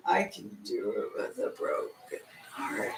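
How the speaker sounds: a ghostly voice from nowhere; disembodied voice. Pretend. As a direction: unseen cult voice whispering the motto. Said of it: "disembodied voice that is going to say".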